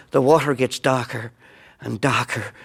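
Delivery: ordinary talk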